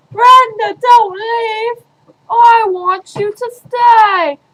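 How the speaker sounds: loud, high pleading; pleading call. Acted. Begging Brenda to stay, loud pleading call. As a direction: beg her not to go.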